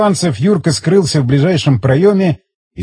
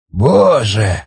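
low, sighed-out exclamation; old man's understated shock; exhaled shock; 5 semitones lower than usual. A narrator's reading. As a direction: breathe it out, stunned. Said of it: "выдохнул старик".

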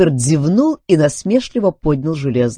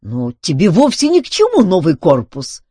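louder, higher objection; exclaiming; 6 semitones higher, 6 dB louder than usual. A narrator's reading. Duncan refuses Filbert a new body, exclaiming. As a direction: protest at the demand, raised voice. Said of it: "воскликнул Дункан".

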